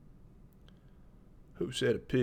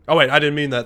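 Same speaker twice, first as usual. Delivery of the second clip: flustered; 3 semitones higher, 11 dB louder, much faster than usual. Real, not acted. Flustered backpedal after a joke.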